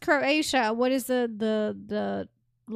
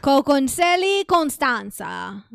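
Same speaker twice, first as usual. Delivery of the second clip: loud, drawn-out put-on Italian accent; 2 semitones higher, 6 dB louder, slower than usual. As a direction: announce it slowly in a mock Italian accent. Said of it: "put your Italian accent on".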